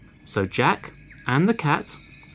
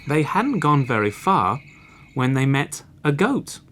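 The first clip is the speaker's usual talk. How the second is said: ordinary delivery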